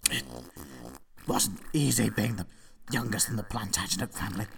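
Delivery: very soft, faint last words; weak dying voice. Acted. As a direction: fade out like a dying king. Dying confession.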